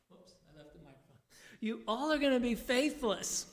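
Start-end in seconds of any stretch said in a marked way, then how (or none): 1.6-3.4 same breezy line again, a little louder and higher. Acted. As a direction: restart it with more energy. Retake after microphone aside.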